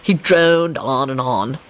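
low, slow drawl; lazy, unhurried storytelling; idle. A narrator's reading. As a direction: draw it out low and bored. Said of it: "Lupin responded idly".

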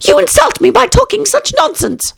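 high, huffy mouse voice; offended mouse. A narrator's reading. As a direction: put on a prim, offended squeak.

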